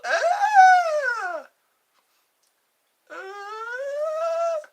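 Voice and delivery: high, loud imitated child's cries; mock kid wail. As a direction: wail like a kid who just got hurt. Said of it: "and then they go".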